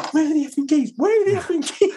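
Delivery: very high-pitched mock-frantic mum; hammed-up exasperated squeal, breaking into laughter; flustered mum. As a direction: imitate a mum rushing round in a panic. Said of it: "Mum was running around going".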